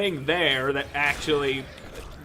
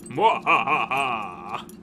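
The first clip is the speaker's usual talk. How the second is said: slow mad scientist cackle; evil cackle; much slower than usual. Acted. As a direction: cackle like a villain. Evil laugh while lockpicking.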